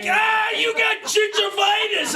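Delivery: high, disgusted mock outburst; upset. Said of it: "She was so upset".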